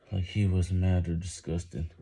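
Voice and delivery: ordinary delivery, nothing marked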